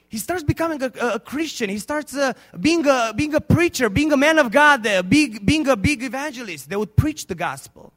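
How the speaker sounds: loud, rising preacher's climax; preaching at full force. Acted. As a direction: build the sermon to a loud cry. Preaching the evangelist's rise.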